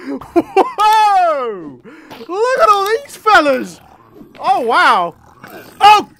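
loud high whoa at the crowd of enemies; startled excitement. Real, not acted. Player spotting a crowd of brutes, loud startled shout. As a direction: shout it as the horde appears.

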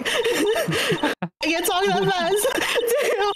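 laughing, high and slow; cracking up mid-line. Real, not acted. Breaks up reading fast lines.

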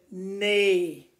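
loud whinnying neigh; a big horse neigh to show the kids. Shown as a demonstration. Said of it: "When the horse talks, say".

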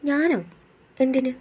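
a small puzzled question. Acted. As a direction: ask it wide-eyed and unsure. Puzzled by his offer.

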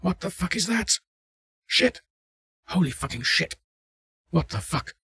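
high-pitched, startled swearing; panicked yelling. A narrator's reading. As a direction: yell in shock at what you see.

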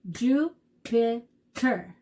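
"Jupiter" very slowly in three separate beats; syllable by syllable. Shown as a demonstration. Teacher clapping a planet's name for kids, "Jupiter" slowly syllable by syllable. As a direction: clap out "Jupiter" one syllable at a time. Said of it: "fun to clap out the syllables".

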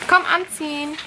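high, soft coaxing. Real, not acted. Mutter redet mit Kleinkind. (sanfte Babysprache, hoch)

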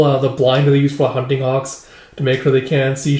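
usual speaking voice

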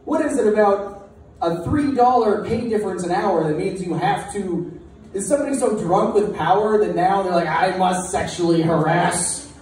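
high, worked-up mock outrage; incredulous rant. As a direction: rant in disbelief at the rule. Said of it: "I was like".

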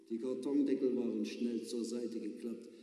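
plain reading voice

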